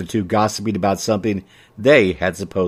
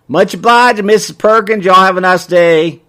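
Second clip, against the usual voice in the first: high, loud folksy send-off; hearty shopkeeper farewell; 10 semitones higher, 8 dB louder than usual. A narrator's reading. Shopkeeper ignores the comment and sees customer off, hearty. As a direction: sing out the thanks, high and loud.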